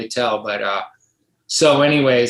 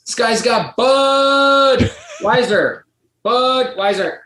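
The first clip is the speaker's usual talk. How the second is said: slow, high, louder teasing shout; mock yell; 10 semitones higher, 4 dB louder, much slower than usual. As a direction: bellow it across the lot to embarrass him. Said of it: "He starts yelling".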